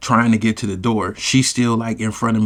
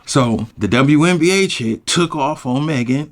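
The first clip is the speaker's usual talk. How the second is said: ordinary delivery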